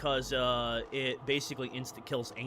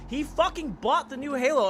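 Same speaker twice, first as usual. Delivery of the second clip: shouting in disbelief, pitched up; loud outrage; 11 semitones higher, 8 dB louder than usual. Real, not acted. Outraged about friend buying Halo.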